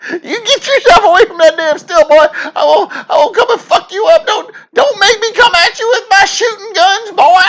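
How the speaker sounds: put-on shrieking falsetto old-timer yelling at a boy, very high and loud; old prospector shouting. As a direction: holler at the kid to get off your still like a crazed old prospector. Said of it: "I'm picturing the old prospector".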